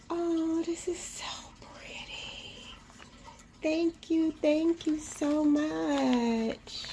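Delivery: high, drawn-out, touched thanks; moved gushing. Real, not acted. Vlogger thanking the maker for the gift, deeply moved. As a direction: gush high and slow, real gratitude.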